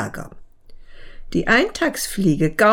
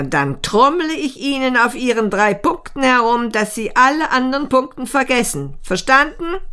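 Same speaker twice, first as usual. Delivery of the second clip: higher, brisk, threatening ant; bossy ant; 6 semitones higher than usual. Acted. Ant threatening the beetle over its baggage, bossy and high-pitched. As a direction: lay down orders with a sharp final demand.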